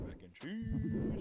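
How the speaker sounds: drawn out and grand; dramatic slow announcement. Acted. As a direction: announce it like a movie trailer.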